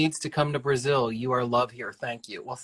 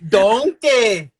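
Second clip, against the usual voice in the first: loud, higher mock Scottish call of "Donkey"; Shrek's Scottish shout; 7 semitones higher, 8 dB louder than usual. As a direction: bellow "Donkey" like Shrek in a put-on Scottish accent. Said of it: "what is your best Scottish accent".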